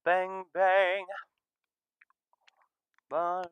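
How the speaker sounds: normal talking voice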